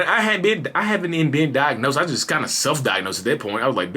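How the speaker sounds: usual speaking voice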